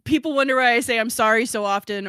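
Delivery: usual speaking voice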